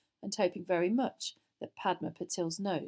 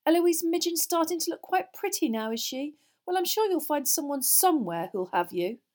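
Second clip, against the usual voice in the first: put-on high, loud, condescending girl; loftily; 10 semitones higher, 8 dB louder than usual. A narrator's reading. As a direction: taunt him with false reassurance. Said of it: "said Hermione loftily".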